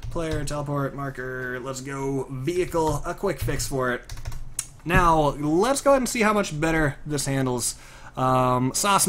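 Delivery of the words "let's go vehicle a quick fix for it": ordinary talk, nothing marked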